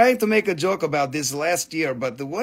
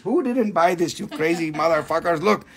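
ordinary delivery

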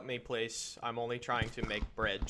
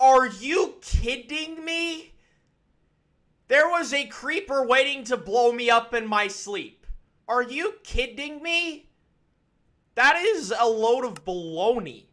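much higher, loud disbelieving shout; outraged; 13 semitones higher, 15 dB louder than usual. Real, not acted. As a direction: rage at the creeper ambush. Creeper destroyed his bed.

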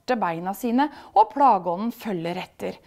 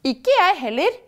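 high, louder firm refusal; determined; 10 semitones higher, 6 dB louder than usual. A narrator's reading. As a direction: refuse decidedly in a raised voice. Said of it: "sier drømmeprinsen bestemt".

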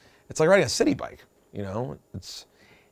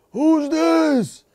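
high, louder mock phone yell; high-pitched retold holler; 11 semitones higher, 4 dB louder than usual. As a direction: imitate him yelling down the phone. Retold shouted phone answer.

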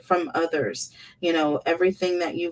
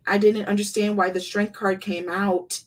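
ordinary talk, nothing marked

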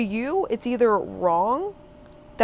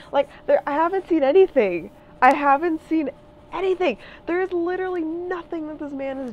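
louder and higher, voice rising in frustration; heated outburst; 8 semitones higher, 4 dB louder than usual. Real, not acted. Ranting to the viewers about the president, heated and raised. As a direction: let the frustration burst out.

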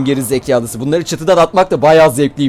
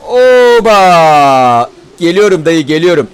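calling out; 7 dB louder, slower than usual. Real, not acted. Calling out to an NPC.

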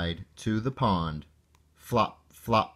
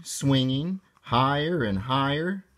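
usual speaking voice

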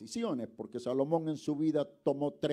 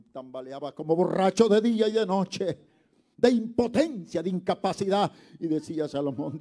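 loud, high, driving preacher's cry; fiery pulpit build; preaching at full force; 8 semitones higher, 12 dB louder than usual. Acted. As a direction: hammer it to the congregation. Preaching Solomon's weeping.

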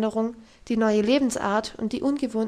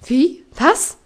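loud, high disbelief; outraged shock; 4 semitones higher, 10 dB louder than usual. Acted. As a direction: snap out the questions in shock. Governess outraged at Heidi.

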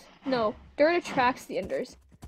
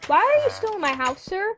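high, loud exasperated telling-off; loud huffy complaint to the villager; indignant scolding; 5 semitones higher, 5 dB louder than usual. Real, not acted. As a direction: scold the stranger in your house. Scolding villager in her house.

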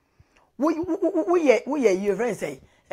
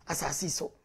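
a soft, breathy whisper; 6 semitones lower, 11 dB quieter than usual. Acted. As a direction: drop to a whisper to close the point. Unvoiced drop at sentence end.

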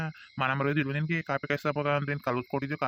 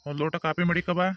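raised, impatient call to someone indoors; 3 semitones higher than usual. Acted. Husband calls his wife to bring coffee, calling out. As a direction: call out insistently, as if unheard. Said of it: "ಏನಂಗ್ ಕೂಗತಾ ಇದ್ಯೆ?".

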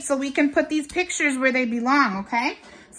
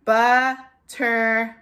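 "Butterfly" in three slow beats, but, ter, fly; split into syllables; slower than usual. Shown as a demonstration. Teacher feels a tricky word, "Butterfly" split in three. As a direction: break "Butterfly" into clearly separate pieces. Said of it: "Let's feel it again".

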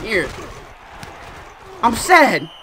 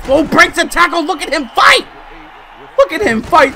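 higher, louder excited yell; hyped; 7 semitones higher, 5 dB louder than usual. Real, not acted. Gamer cheering his quarterback's run, excited yell. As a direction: cheer the runner on.

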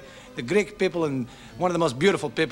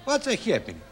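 put-on high "Hey, baby" sliding down; Hydra inflection; 9 semitones higher than usual. Shown as a demonstration. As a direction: mimic a Hydra islander's lilt. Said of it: "someone on Hydra say".

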